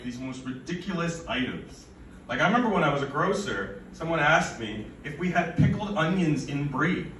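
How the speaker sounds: ordinary talk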